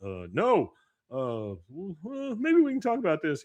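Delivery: high, hesitant mock-worried voice; nervous backing down. A cowed man pleading to talk it over instead, nervously. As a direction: stammer and squeak through a timid protest. Scared Bill backing out of fight.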